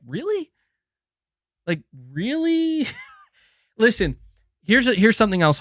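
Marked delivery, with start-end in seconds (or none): 0.1-2.9 drawn-out high disbelieving really; slow, high, can't-believe-it question; stretched incredulous rise. Real, not acted. Incredulous at game writing.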